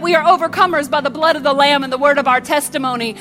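earnest prayer building in force; spoken prayer. Acted. Pastor praying over the congregation at the close, in earnest prayer. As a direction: pray over them, rising with faith.

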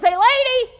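high, loud parrot call; parrot heckle. Acted. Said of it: "Parrot goes".

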